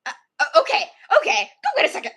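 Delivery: high, loud, stammered surrender; flustered giving in. Retold or imitated. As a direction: act out backing down in a fluster.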